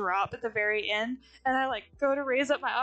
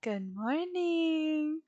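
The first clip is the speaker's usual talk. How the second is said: sheepish greeting; much slower than usual. Retold or imitated. Greeting startled partner, slow and sheepish. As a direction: draw it out gently. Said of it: "and I'm like".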